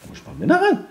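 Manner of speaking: beaucoup plus haut et fort; imitation criée. Shown as a demonstration. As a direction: joue celui qui crie. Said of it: "j'imite ce que l'autre". (imite un cri, voix haute)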